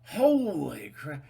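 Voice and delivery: drawn-out loud astonished gasp of words. Retold or imitated. The listener spotting the elephant, slow loud astonishment. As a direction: blurt it out slowly in shock.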